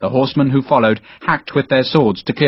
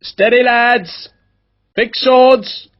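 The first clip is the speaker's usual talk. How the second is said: steadying shout; 12 semitones higher, much slower than usual. Acted. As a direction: hold the nervous men together. Officer steadying troops in battle.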